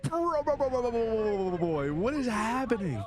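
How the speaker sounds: high alarmed cries of bro; panicked disbelief, voice jumping up; shocked. Real, not acted. Streamer shocked watching tornado clip.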